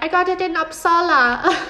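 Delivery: lightly faked Scandinavian accent; failed attempt at Freydis's accent. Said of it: "I can't do her accent".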